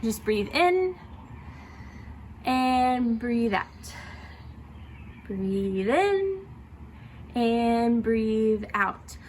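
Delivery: slow, calm breathing cues, stretching the out-breath; guided breathing. Shown as a demonstration. Voice teacher guiding students through slow breaths, unhurried and calm. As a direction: talk them through each breath, drawing it out. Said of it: "Now I just want you to breathe".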